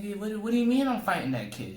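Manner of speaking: high, fast disbelieving protest; incredulous kid. Retold or imitated. Boy protests to his dad about being made to fight, high and fast. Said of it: "I'm like".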